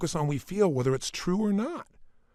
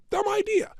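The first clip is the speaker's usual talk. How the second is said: much higher, mocking scoff; scoffing listener; 3 dB louder than usual. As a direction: dismiss the idea with a sneer. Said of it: "You go".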